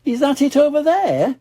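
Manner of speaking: high curious mother voice; high cartoon mummy pig; Mummy Pig's voice. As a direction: ask it high and hopeful.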